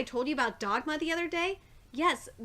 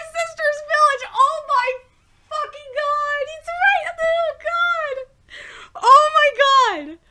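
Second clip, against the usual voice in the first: much higher and very loud; frantic shouting; 12 semitones higher, 10 dB louder, slower than usual. Real, not acted. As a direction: panic over the plot twist. Reader panics at the twist.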